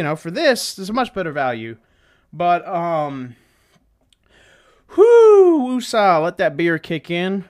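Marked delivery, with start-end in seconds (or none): 5.0-5.6 high, loud drawn-out whoo; loud whoop. Real, not acted. Host letting that beer kick in, loud high whoop. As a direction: let out a long high whoo.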